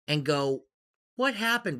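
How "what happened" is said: high, pleading puppy-dog voice; puppy whimper. Retold or imitated. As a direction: ask it like a sad puppy. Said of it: "with, like, the puppy dog voice and eyes".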